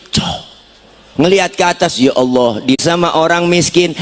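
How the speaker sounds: usual speaking voice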